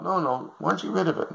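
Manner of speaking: high, shrill-pitched complaining wife; nagging wife. Acted. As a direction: whine it like a disgusted wife. Wife demands dog go.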